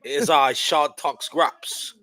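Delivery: slow, grand mock British accent on his own name; horrible British accent. Co-host introducing himself to British fans in a mock British accent. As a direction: announce yourself in a bad, slow British accent. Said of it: "in my horrible British accent".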